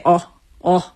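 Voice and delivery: short, pure Scandinavian "oh, oh" with no glide. Shown as a demonstration. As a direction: clip each "oh" flat like a Swede or Finn. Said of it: "if you're from, uh, Finland or Sweden, you say".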